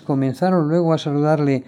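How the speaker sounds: ordinary delivery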